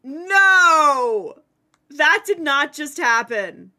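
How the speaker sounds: loud disbelieving no; shocked cry. Real, not acted. Bad drop, disbelief.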